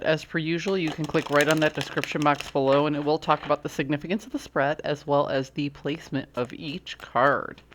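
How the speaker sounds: normal talking voice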